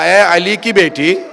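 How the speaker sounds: slow, loud call of devotion; a loyal companion's loud pledge; loud vow. Acted. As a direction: call out your oath to her, slowly. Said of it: "آواز دی".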